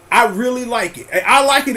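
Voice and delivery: ordinary talk, nothing marked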